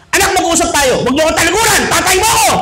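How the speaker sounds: a father demanding his son face him; stern dad calling his son back. Retold or imitated. Angry father voiced in story.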